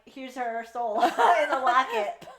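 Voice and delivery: high, laughing through it; stifle laughter delivering the line; laughing mock offer. Fan retells Rowington's line, laughing. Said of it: "Rowington's like".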